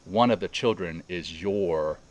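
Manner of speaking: slow, deliberate phrasing with pauses; measured, unhurried quote; retold guided visualization. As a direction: retell the guide's words slowly, letting each land.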